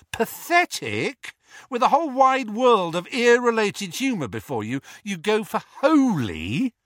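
high, incredulous, rising scorn; scornful outrage. A narrator's reading. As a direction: tear into your brother's feeble joke. Twin mocks brother's weak pun.

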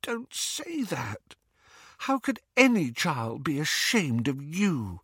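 high, tearful hushed pleading; tearful whisper. A narrator's reading. Hermione reassuring Remus about his child, tearful and high. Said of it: "whispered Hermione, tears in her eyes".

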